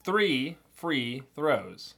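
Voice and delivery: very slow, careful Three free throws; slow tongue twister. Shown as a demonstration. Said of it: "Pick one of those on that list".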